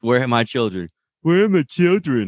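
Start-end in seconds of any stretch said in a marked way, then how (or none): 1.2-2.3 put-on high, overdone Sokovian accent from Wanda; mock Sokovian accent. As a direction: ham up Wanda's Sokovian accent, much higher. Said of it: "that accent really goes".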